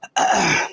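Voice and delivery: harsh choking cough; coughing up water. Real, not acted. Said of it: "some water went down the wrong way".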